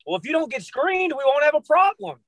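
mock-earnest high-pitched coach impression; dead serious. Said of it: "looks at me and dead serious".

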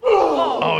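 loud, high-pitched "oh"; excited exclamation. Real, not acted. Host reacting to another crack, excited. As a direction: burst out with a high cry.

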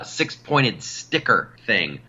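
ordinary delivery

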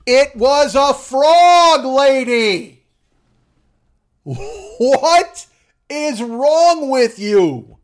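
slow, high, loud drawn-out yell; stretched-out outraged shout; exasperated shout. Retold or imitated. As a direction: bellow each word in disbelief. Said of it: "And I'm like".

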